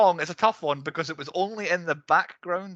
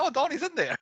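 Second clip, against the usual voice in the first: surprised realisation; 12 semitones higher than usual. Retold or imitated. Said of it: "It's like".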